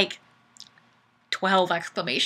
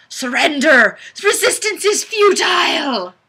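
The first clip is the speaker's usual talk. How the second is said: higher and louder, a hammed-up war shout; mock battle cry; 5 semitones higher, 5 dB louder than usual. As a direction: yell it like a cartoon invader.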